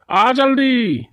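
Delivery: ordinary talk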